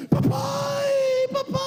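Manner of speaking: put-on high, loud wailing little girl; dramatic crying child. Pastor imitating his little daughter wailing for him at school. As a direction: cry for daddy like a hurt child making a scene. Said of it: "ela tava fazendo uma cena".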